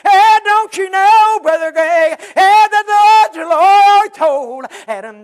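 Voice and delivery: sung, high, loud and slow preacher's whoop; full-force musical whoop over the congregation; whooped preaching. Acted. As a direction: lift it into the chanted climb of a hacking preacher.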